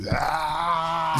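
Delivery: short mock goblin shriek; goblin cry. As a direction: yelp like a startled goblin. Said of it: "and they just go".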